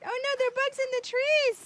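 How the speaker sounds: mock alarm. Pretend bug chase with toddler.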